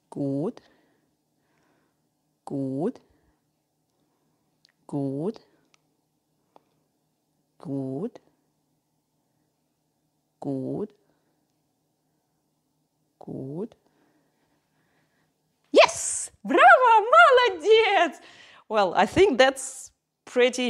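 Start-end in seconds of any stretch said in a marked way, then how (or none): 0.1-13.7 low, soft good with a rising lilt; calm steady encouragement; soft low praise. Real, not acted. Praising dog during stay. 15.7-18.2 high, loud gushing praise; excited praise. Real, not acted. Praising dog after success.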